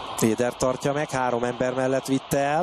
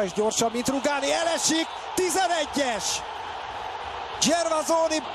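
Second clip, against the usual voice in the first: excited penalty call; 9 semitones higher than usual. Real, not acted. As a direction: shout the penalty decision. Penalty awarded, called live.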